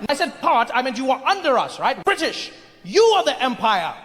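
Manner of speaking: hammed-up British superiority; condescending British imperialist. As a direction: set them straight with pompous emphasis. British person corrects the Indian.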